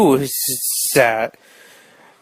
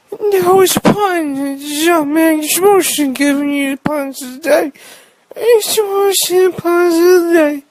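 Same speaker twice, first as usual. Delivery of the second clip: hammed-up pun announcer; 12 semitones higher than usual. Mock podcast host's pun sting.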